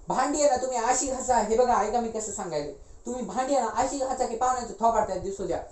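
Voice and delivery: brisk, high, ordering the women about; bossy household woman. Acted.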